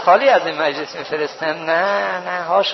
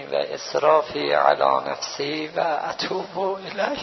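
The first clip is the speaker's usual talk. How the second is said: slow dhikr; 2 semitones lower, slower than usual. Real, not acted. Preacher leading mourners in repentance, slow chanted prayer. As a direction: breathe each phrase out slowly in prayer.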